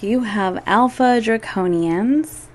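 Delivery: ordinary talk, nothing marked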